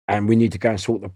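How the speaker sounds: usual speaking voice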